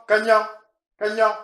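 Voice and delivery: "kanya" with the second A rounded to an open aw; accented second vowel. Shown as a demonstration. Teacher telling hand apart from five, accented second vowel. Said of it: "An accent on the sec-, uh, the second A".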